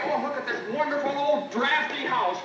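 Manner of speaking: usual speaking voice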